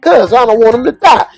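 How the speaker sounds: very high-pitched imitated plea; mock pleading. Friend begs Chauncey to save wounded man, mock pleading. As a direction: jump up high, like a comic desperate friend.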